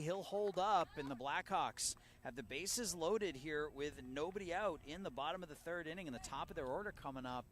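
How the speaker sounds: big loud high call of the hit; excited home run style call. Real, not acted. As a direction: shout the hit to the whole ballpark. Base hit loads the bases.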